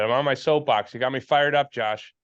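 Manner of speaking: ordinary talk, nothing marked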